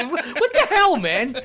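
high-pitched, indignant reaction with a laugh behind it; mock outraged disbelief. Retold reaction to cat knocking cup.

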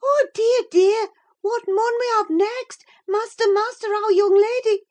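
frantic, very high, loud maid's cry; crying out in alarm. A narrator's reading. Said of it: "dashed into the chamber, crying".

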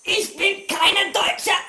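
German, langsam und gespielt; mock German. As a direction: ham up the German. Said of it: "I'm not German".

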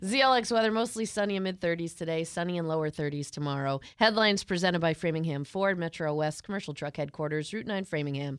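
radio weather report; radio read. Acted. Reads the weather and station ID.

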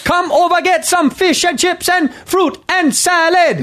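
high, slow Jamaican-sounding Irish; high-pitched Irish gone Jamaican; botched Irish accent. Shown as a demonstration. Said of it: "that sounds so Jamaican".

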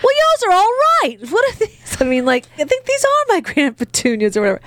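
very high, fast prissy voice; high mock voice. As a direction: squeak it out snootily. Mocking Aunt Petunia's voice.

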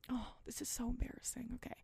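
whispered aside, half to herself; embarrassed whisper. Real, not acted. Host confessing a cringe memory, whispering. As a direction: whisper it like you can't believe you're admitting this.

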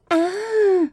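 put-on high, breathy baby-girl sigh. Shown as a demonstration. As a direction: coo it like a breathy baby doll.

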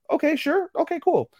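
very high-voiced acted okay; high-pitched agreement. Retelling his reply to her offer to babysit, high-pitched agreement.

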